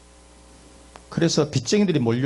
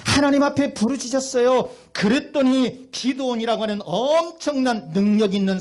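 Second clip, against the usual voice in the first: high-pitched, forceful preacher's cry; preaching at full force, pitch soaring; impassioned preaching; 10 semitones higher, 4 dB louder than usual. Real, not acted. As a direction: cry it out to the whole congregation.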